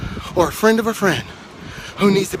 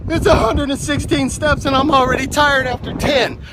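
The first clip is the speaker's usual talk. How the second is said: weary complaint, pitched higher; discouraged take; 6 semitones higher than usual. Shown as a demonstration. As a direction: whine it like it's too much. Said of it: "or I can go".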